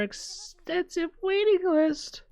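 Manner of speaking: high, drawn-out wonder ending in a gasp; awed gasp. Acted. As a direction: marvel at the impossible snacks. Awed gasp over rare snacks.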